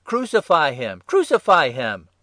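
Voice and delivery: loud, high, insistent chant-like shout; crowd shouting. A narrator's reading. Priests and officers demanding crucifixion, shouting. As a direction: shout it like a mob demanding death. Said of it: "they shouted".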